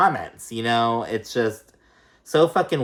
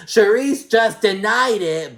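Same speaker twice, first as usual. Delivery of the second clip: much higher, louder and slow dumb mimicry; mock her as loud and slow-witted; mocking Giselle; 10 semitones higher, 6 dB louder than usual. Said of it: "Giselle looked dumb as fuck".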